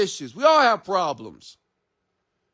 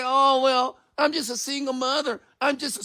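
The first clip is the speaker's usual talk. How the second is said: mocking single mother; 7 semitones higher than usual. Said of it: "Don't say".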